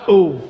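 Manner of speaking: mocking ooh. Mock reaction to curse.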